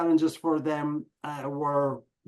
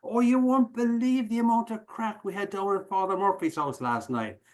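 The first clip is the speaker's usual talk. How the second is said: lightly voiced nun, higher, gushing; a hint of a gossiping old nun; chatty Irish nun; 6 semitones higher than usual. Telling American audiences about a nun's gossip, lightly voiced and gushing. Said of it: "you'll hear Sister Mary Margaret say".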